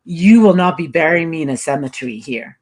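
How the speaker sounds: ordinary talk, nothing marked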